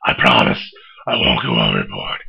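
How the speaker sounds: deep gravelly Batman growl; low, growling caped crusader. Acted. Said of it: "it's getting really hard to talk like that".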